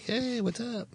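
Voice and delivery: high, soft put-on greeting; mock movie dudes. Film fan imitates the guys from Ted, high put-on greeting.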